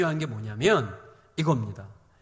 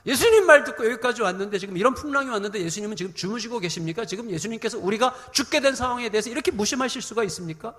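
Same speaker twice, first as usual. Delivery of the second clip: high, pressing mock outrage; angry protest; 5 semitones higher, 5 dB louder than usual. Disciples confronting sleeping Jesus in the storm, acted angry protest. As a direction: hurl indignant questions in a raised voice. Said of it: "이런 식으로 막 화를 내는 거예요".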